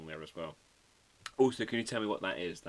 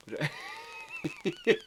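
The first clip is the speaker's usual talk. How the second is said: high-pitched burst of laughter; real laugh. Real, not acted. Amused at forgetting marshmallow.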